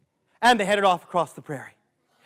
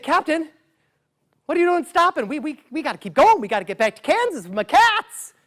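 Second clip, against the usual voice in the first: urgent Gabe; 9 semitones higher than usual. Acted. Gabe urging boat captain.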